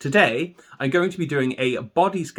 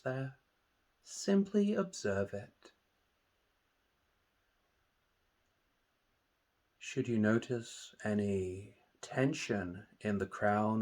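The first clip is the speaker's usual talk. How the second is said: guided meditation; 2 semitones lower, 10 dB quieter than usual. Acted. Meditation teacher leading listeners through a body scan, calm and soothing. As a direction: calmly guide listeners into relaxation, unhurried and hushed.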